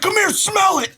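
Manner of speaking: high, indignant mock shout; retold yell. Partner ordering friend to smell the wet clothes, yelling. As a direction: order it in outraged disbelief. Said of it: "So I yell at you".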